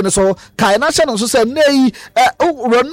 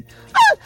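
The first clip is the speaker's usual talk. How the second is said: very high squeak of exasperation; a comic falsetto yelp; mock high squeal. Imitating Ronaldo fed up with critics, high mock squeal. Retold Ronaldo complaint at press.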